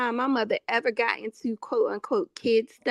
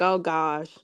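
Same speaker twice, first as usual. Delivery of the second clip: lower, weary "oh gosh"; mock dread; 6 semitones lower than usual. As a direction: groan it like a reluctant sister. Sighing at sister.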